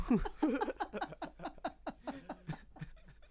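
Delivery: high, wheezy run of laughter; real laughing fit. Real, not acted. Podcaster cracks up at own innuendo, real laughing fit.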